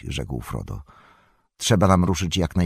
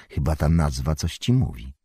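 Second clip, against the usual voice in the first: usual speaking voice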